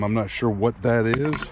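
usual speaking voice

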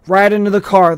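loud clipped command; order. A narrator's reading. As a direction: push them into the car. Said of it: "the man ordered".

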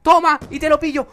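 very high, loud victory yell; triumphant gloating shout. Real, not acted. Gamer screaming in triumph over a kill. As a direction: crow it over the beaten rival.

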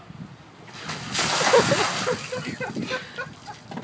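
high gasping laugh from the icy shock. Real, not acted. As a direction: laugh out through a cold gasp. Ice water just poured over him.

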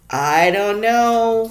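put-on hesitant child's fearful answer; fearfully. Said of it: "Winnie said fearfully".

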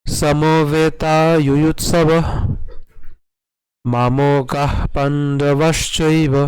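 slow, drawn-out Sanskrit recitation; recitation to repeat. Shown as a demonstration. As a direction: recite for learners to follow, pausing after lines. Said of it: "অবশ্যই পাঠ করবেন".

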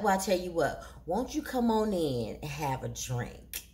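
low, soft smooth persuasion; deep, quiet come-on; coaxing invitation. Retold or imitated. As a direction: imitate a man luring her in. Man coaxing her inside, retold.

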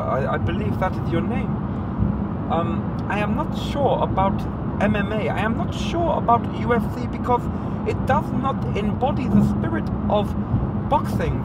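lightly done Eubank, grand and deliberate; Chris Eubank impression. Comedian doing Eubank lecturing McGregor, formal posh delivery. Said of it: "Eubank impersonation".

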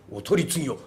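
ordinary delivery

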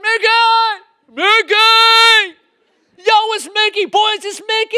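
high, very loud, drawn-out fan yell; excited shouting. Acted. Dad spotting Mickey and calling his boys, excited shouting. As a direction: holler it across the street, overjoyed.

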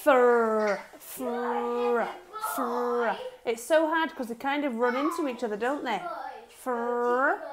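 fur, consonants run together; segment fur. Shown as a demonstration. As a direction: stretch fur slowly apart. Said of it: "Can you separate them".

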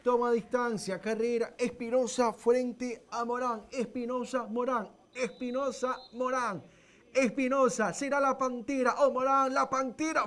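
rising, tense penalty call; live play-by-play. Real, not acted. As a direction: build the suspense over the kick.

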